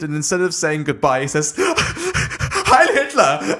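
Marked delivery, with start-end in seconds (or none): 1.6-3.6 very high, slow, giddy and laughing; excited Heil Hitler. Retold or imitated. A smitten Nazi saying goodbye, giddy high-pitched cheer. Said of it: "he's so excited".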